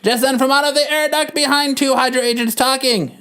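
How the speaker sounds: ordinary talk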